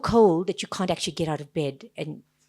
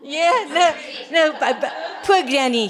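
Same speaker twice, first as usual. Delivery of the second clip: laughing; 8 semitones higher, 9 dB louder than usual. Real, not acted. Laughing at granny memory.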